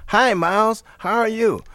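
high, chirpy mimicked greeting; put-on high voice greeting an idol. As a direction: pitch it high like an overfriendly fan.

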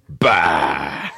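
loud gruff dismissive "bah"; big, low, drawn-out scoff; Mister Giammalvo. Acted. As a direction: shout it off like a grumpy old neighbor. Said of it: "just would wave his hand and go".

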